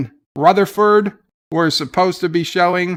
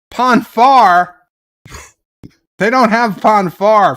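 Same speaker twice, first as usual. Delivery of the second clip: loud, higher burst of disbelief; exclaimed outburst; 4 semitones higher, 5 dB louder, slower than usual. Real, not acted. Ranting to stream chat about Star Trek, loud outburst. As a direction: blurt it out incredulous.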